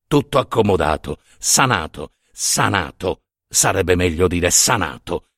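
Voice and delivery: low, understated swagger; cocky, slightly mocking. A narrator's reading. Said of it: "spavalda e un po' canzonatoria".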